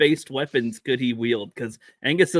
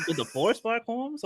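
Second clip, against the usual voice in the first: put-on higher Mexican accent; 9 semitones higher, 7 dB quieter than usual. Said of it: "Can you say".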